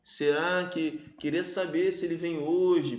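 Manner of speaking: slow, drawn-out wondering aloud; lingering, speculative question to oneself; musing, wondering. Shown as a demonstration. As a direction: drift off into a hypothetical, very slowly. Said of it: "naquele mundo da hipótese, né, da divagação".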